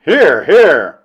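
loud chorus-style approval; meeting's cheer. A narrator's reading. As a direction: call it out heartily from the floor. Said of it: "from all parts".

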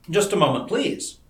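ordinary delivery, nothing marked

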